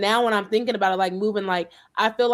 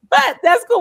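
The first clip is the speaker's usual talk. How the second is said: excited; 14 semitones higher, 9 dB louder than usual. Real, not acted. Same high school.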